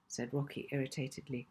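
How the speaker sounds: ordinary delivery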